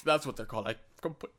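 talking through hiccups; hiccuping mid-sentence. Real, not acted. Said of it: "oh, sorry, I got the hiccups".

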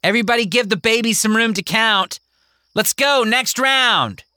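put-on herd the kids off; bossy girl. Mary ordering the other kids, high and bossy.

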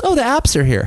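high, airy, breezy voice; mock nonchalance. [name] ignoring her new mohawk, high and breezy. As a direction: act like nothing happened, brightly. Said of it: "I would just sit down and be like".

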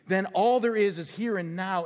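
ordinary talk

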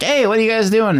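high-pitched mock challenge; calling out, imitated. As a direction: call out like you caught them at it. Voicing Leon catching the vandals.

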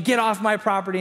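retold scream. Neighbor backing away from a man on her driveway, mock screaming. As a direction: imitate someone yelling in alarm, half-strength.

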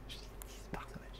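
répète tout bas, sans voix; chuchotement abasourdi. Acted. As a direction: souffle les mots lentement, comme sous le choc. Répète le chiffre, comme abasourdi. (chuchoté, lent, presque inaudible)

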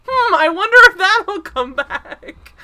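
high, loud, drawn-out sing-out of mock suspense; hammed-up wondering with laughter underneath. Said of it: "could not be more ominous".

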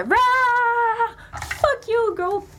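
roaring grunting brute. Acted. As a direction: goad the giant into attacking. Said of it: "Fait que je fais".

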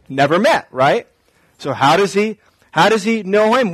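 ordinary talk, nothing marked

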